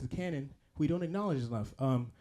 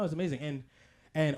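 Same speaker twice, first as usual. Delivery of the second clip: usual speaking voice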